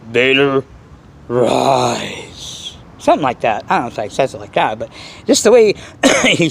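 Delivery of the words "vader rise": deep, drawn-out wicked command; low and slow, like a dark lord summoning his apprentice; Emperor Palpatine. Acted. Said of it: "in that deep, wicked voice".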